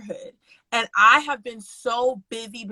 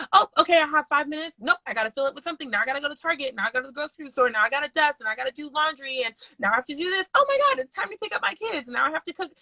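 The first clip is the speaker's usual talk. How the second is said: higher, hurried rattling off of chores; acted-out overwhelm, pitched up and busy; frantic inner monologue; 5 semitones higher, faster than usual. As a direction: rush through a mounting mental to-do list. Acting out frantic inner to-do list.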